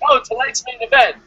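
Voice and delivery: normal talking voice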